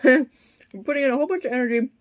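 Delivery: talking through a mouthful; chewing while talking; mouth full. Real, not acted. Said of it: "I'm eating Finding Nemo right now".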